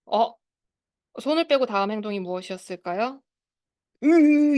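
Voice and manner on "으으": loud, high mock growl of frustration; angry groan. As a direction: imitate a child's angry grunt, loud. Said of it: "이렇게 화냈어요". (화내는 아이 흉내로 크게 으으)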